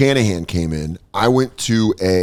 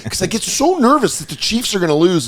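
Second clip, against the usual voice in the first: whiny nervous fan; 8 semitones higher than usual. Retold or imitated. Podcaster mocking a nervous Chiefs fan, high whiny voice.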